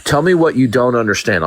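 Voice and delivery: ordinary delivery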